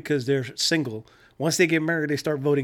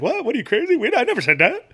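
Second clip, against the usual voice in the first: mock indignation; 9 semitones higher, 4 dB louder than usual. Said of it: "he's like".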